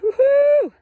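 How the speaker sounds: high, loud whoop of joy; excited cheer. Real, not acted. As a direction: let out a big happy whoop. Cheering about Halloween pumpkins.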